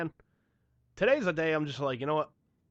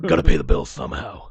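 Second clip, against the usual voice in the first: Batman; 11 semitones lower, 10 dB louder than usual. Pretend. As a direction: growl it like the caped crusader.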